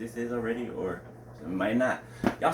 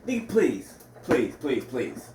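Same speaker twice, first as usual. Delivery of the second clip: scoffing, louder then fading; dismissive; 2 semitones higher, 3 dB louder, slower than usual. Real, not acted. Scoffing at the husband's excuse, dismissive. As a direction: brush the excuse off.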